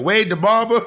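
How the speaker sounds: mock New York accent, Barber with dropped r; imitated New York accent. Teasing co-host about their pronunciation, mock New York accent. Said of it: "maybe that was your New York accent".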